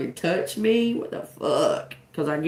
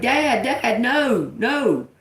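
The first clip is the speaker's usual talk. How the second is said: higher-pitched mock boy's protest; alarmed boy; 6 semitones higher, much slower than usual. Boy trying to stop dad, mock alarm. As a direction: imitate a boy urgently stopping a parent. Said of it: "And then the boy, he was like".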